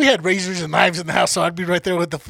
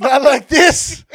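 slow, louder, higher mock anguish; hammed-up childhood despair; 6 semitones higher, 8 dB louder, much slower than usual. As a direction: drag it out like a heartbroken kid. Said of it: "I was like".

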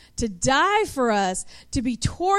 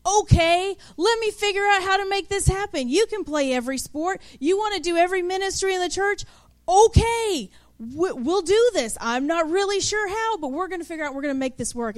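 eager mom; 5 semitones higher than usual. Retold or imitated. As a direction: gush with can-do enthusiasm. Mom eagerly agreeing to everything.

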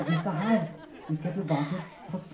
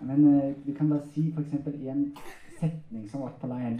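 ordinary talk, nothing marked

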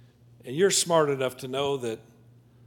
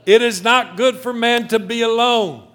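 higher, booming declaration; loud proclamation; 6 semitones higher, 10 dB louder than usual. Acted. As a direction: proclaim it to the back row. Preacher's climactic proclamation.

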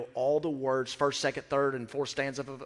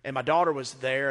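ordinary delivery

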